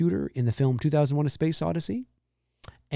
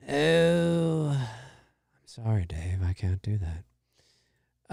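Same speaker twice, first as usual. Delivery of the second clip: low, very slow, calm computer voice; slow, eerie machine refusal; HAL 9000 impression; 6 semitones lower, 6 dB quieter, much slower than usual. Streamer repeats the movie line, low and slow.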